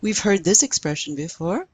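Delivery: ordinary delivery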